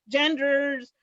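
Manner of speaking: ordinary talk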